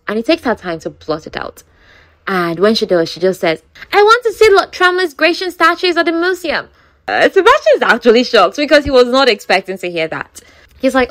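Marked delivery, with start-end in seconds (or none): 3.9-6.7 higher, eager and proper; a lady confessing a daring wish; prim lady voice. Retold or imitated. Lottie voices her secret desire.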